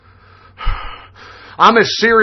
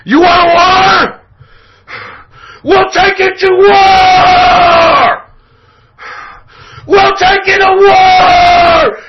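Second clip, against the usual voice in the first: furious full-throated scream, much higher and louder; enraged shouting; 11 semitones higher, 5 dB louder than usual. Real, not acted. Radio host threatening war on leftists, enraged shouting. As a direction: bellow it, dragging out the war cry.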